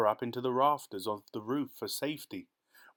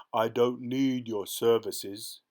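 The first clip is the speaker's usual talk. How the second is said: slow, louder ogre growl; 5 dB louder, slower than usual. Acted. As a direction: growl the refusal with menace. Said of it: "he growled".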